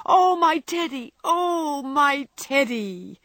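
high, loud, overjoyed exclamation; joyful cry. A narrator's reading. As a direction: cry it out in delight, drawing out the words. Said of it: "crying joyfully".

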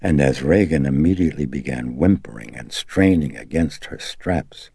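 ordinary delivery, nothing marked

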